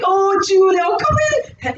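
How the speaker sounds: high, hearty welcome; jolly husband. Retold or imitated. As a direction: call them in as a jolly husband. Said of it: "he's, like, really jolly and everything".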